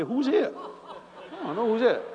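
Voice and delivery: comic who-can-it-be voice; mock bewilderment. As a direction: play baffled, looking around for someone else. Acting baffled at his introduction.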